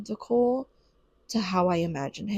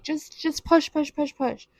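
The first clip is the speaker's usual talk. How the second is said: higher, lightly urgent chanting; mock urging coach; 6 semitones higher than usual. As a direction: imitate someone egging on a push. Mimics urgent coaching in delivery room.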